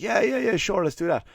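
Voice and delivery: high, fast yes-yes placating voice; mock eager agreement. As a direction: brush her off with a squeaky eager yes. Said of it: "the guy was like".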